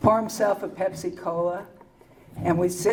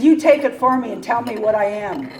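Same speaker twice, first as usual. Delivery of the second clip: laughing retort; 5 semitones higher, 9 dB louder than usual. Retold or imitated. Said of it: "And I said".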